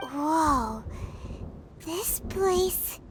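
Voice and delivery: low, hushed whoa of wonder; awed gasp. Acted. Arriving somewhere strange, a hushed amazed whoa. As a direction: let it slip out in amazement.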